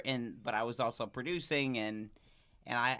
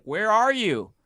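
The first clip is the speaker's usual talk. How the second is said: loud, high panicked demand; acted panic, pitch leaping up; freaking out; 9 semitones higher, 13 dB louder, slower than usual. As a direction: imitate a frantic caller yelling down the phone. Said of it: "This lady is freaking out".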